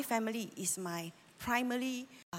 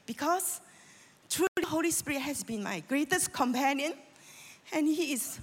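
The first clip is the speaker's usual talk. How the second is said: ordinary talk, nothing marked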